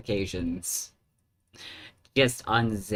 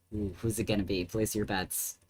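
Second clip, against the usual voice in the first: ordinary talk, nothing marked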